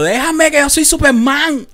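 put-on high, slow drunken yell; shouting Superman. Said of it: "Mi hermano y yo gritando". (grito borracho, agudo y lento)

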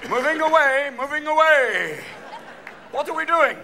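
high, loud, stretched-out announcement; football referee. Acted. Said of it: "football referees who have that".

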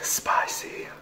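hushed whisper; breathy whispered reaction. Real, not acted. Tasting beans, hushed exchange.

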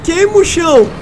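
high, loud desperate push; racing the clock; panicking gamer. Real, not acted.

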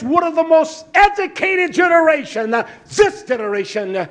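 loud, high, driving preacher's cry; a fiery preacher's sermon shout; full-force preaching. Acted. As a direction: thunder it from the pulpit.

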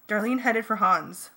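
plain reading voice

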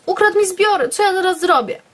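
high, frantic wail of loss; a hint of panicked panting; panicked cry. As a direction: cry it out, breathless and desperate. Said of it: "sapiąc i krzycząc".

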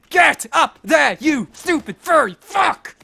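seething shout at the rabbit; drawn-out angry yell. Real, not acted. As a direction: stretch every word out in rage. Raging at the bunny.

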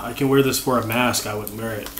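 muffled behind plastic bag; speaking through a snack bag. Real, not acted. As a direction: mouth covered by bag. Seen 2: Snack bag held against face, covering mouth, until about 1.7 s.

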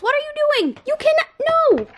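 high-pitched alarmed scold; squeaky no at the cat. Real, not acted. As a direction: shriek at the cat to stop.

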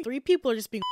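usual speaking voice

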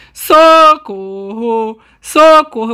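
slow, drawn-out cry for help. Real, not acted. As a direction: wail for help in panic. Calling for help while chased.

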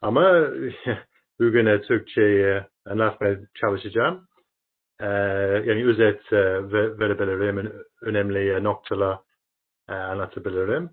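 halting Turkish with foreign accent; foreigner broken turkish. Real, not acted. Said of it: "yani Türkçem çok, e, iyi değil".

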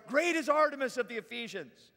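loud chanting declaration; crowd cry. A narrator's reading. Crowd chanting for two hours, crying out. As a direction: cry it out as one mob. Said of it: "all cried out with one voice".

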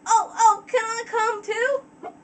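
put-on high, eager country-filly accent; Apple Bloom accent. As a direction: beg to tag along in Apple Bloom's twang. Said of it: "accent I can do for Apple Bloom".

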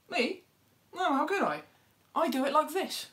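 a smug little bird protesting her innocence; innocent animal voice. Acted. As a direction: play the dove, shocked at the idea. Goat denies it, shows how.

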